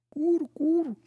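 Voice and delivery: very high, loud dove coo; high-pitched bird call; cooing pigeon. A narrator's reading. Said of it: "riep de vogel".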